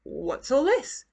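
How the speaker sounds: put-on a startled old man at his door; surprised grandpa. A narrator's reading.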